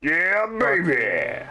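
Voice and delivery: slow hyped cheer; excited drawl. Acted. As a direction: savor it like a win. Hyped exclamation.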